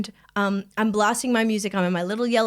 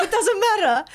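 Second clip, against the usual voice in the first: high, laughing mock dismissal; amused repeat; 8 semitones higher than usual. Woman repeating the suitor's reply to her co-host, laughing. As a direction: repeat his brush-off pitched up, through laughter.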